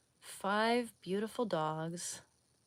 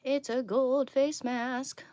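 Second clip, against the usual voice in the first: slow, louder, higher, like presenting; grand announcement; 6 semitones higher, 7 dB louder, slower than usual. Pretend. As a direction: announce it like a game show.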